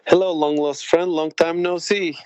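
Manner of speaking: ordinary talk, nothing marked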